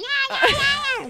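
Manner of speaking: high, loud whiny ña ña; mock tantrum whine. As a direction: mimic a child throwing a fit. Imita berrinche del niño. (imitando el berrinche del niño, agudo)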